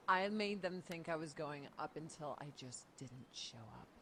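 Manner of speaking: ordinary talk, nothing marked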